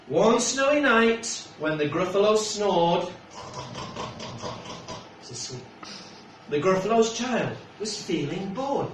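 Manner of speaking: lightly lilting storybook read; gentle read-aloud for a little one; reading to a child. Acted. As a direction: tell it like a bedtime picture book. Reads picture book aloud.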